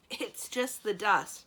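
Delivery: muffled through a handkerchief. Acted. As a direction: speak through a cloth over the mouth. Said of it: "Simrine said in a muffled voice".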